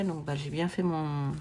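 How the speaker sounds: usual speaking voice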